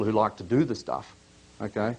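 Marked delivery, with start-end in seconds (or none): none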